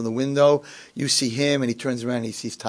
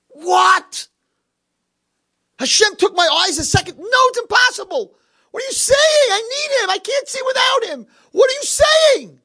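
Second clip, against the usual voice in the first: anguished crying shout; 5 dB louder than usual. Acted. Blind man hears friend died.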